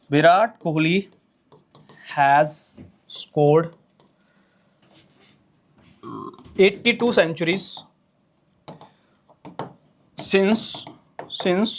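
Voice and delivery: dictating slowly. Shown as a demonstration.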